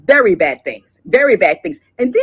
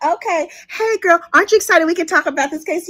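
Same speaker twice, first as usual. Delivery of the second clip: gossiping juror; 5 semitones higher than usual. Pretend. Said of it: "they were like".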